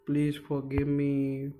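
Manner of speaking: ordinary talk, nothing marked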